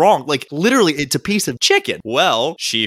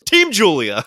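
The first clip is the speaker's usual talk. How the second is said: a mild mock cheer, pitched higher; retold scream; 7 semitones higher, 4 dB louder, slower than usual. Friend retelling how Julia cheered at beer pong, mock yell. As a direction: shout her team name like a fan. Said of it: "Julia screams".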